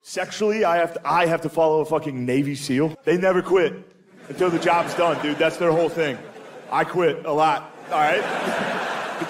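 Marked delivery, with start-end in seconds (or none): none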